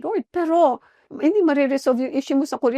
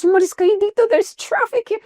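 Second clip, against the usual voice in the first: high, loud rebuke casting out; preacher rebuking evil spirits; commanding shout; 10 semitones higher, 3 dB louder than usual. Acted. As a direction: order the devil out, high and forceful. Commanding Satan to leave.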